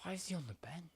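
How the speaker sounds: whispered, baffled aside; hushed puzzled question. Real, not acted. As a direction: mutter it under your breath in a whisper.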